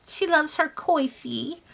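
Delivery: "coifee" said coy-fee with an oi vowel; mock Kim's "coifee", oi in the first syllable; teasing Kim's pronunciation. Host teasing her friend Kim over "coifee". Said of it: "as Kim would say".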